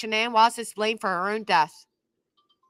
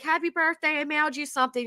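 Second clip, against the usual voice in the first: lightly voiced higher, chirpy friend; imagined best friend; 5 semitones higher, 5 dB quieter, faster than usual. Best friend announcing a mailed birthday present, lightly acted cheer. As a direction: put on a birthday well-wisher's voice, higher. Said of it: "your best friend's like".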